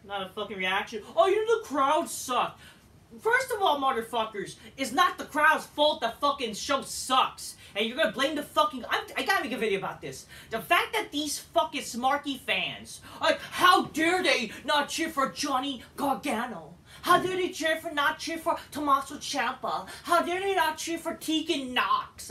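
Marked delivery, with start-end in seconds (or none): 1.2-2.6 mocking complaint. Mocking critics blaming the crowd. 3.2-22.3 angry rant. Real, not acted. Vlogger ranting at fans who blame the crowd, loud and furious.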